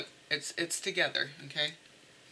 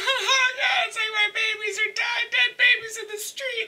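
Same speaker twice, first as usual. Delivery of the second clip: wailing mock grief. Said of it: "That's how I see it".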